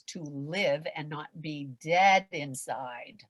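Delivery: usual speaking voice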